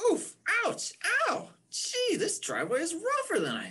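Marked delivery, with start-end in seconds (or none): 0.1-1.5 pained yelps. Acted. Raindrop bumping along rough driveway.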